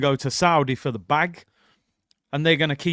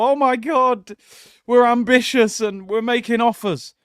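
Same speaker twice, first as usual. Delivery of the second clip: mock giddy gush, higher and louder; mock giddy excitement; 6 semitones higher, 5 dB louder than usual. Fan mocking the club, a hammed-up giddy gush. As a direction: ham up fake fan excitement, pitched up. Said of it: "We're gonna get all giddy and excited and go".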